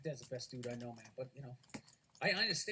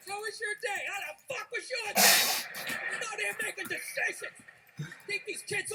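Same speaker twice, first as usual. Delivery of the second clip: mock husband rant; 8 semitones higher, 5 dB louder than usual. Husband unloading on his wife, mock furious snarl.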